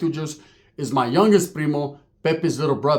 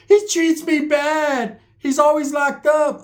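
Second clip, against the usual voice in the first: high, drawn-out wail from the background; exaggerated high-pitched whine; mock wailing complaint; 11 semitones higher, 3 dB louder, much slower than usual. Mimics woman complaining in background.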